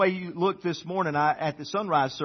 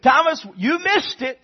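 higher, louder excited call; 8 semitones higher, 6 dB louder than usual. Retold or imitated. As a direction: call it out like a bursting brother. Excited disciples call to Thomas.

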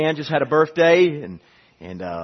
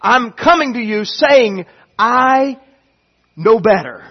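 God insisting; 3 semitones higher, 4 dB louder than usual. Acted. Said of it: "God's saying".

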